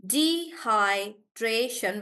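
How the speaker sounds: "Dehydration" said slowly, syllable by syllable; slow syllable read. Shown as a demonstration. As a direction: stretch "Dehydration" so the beats can be counted. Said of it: "So four".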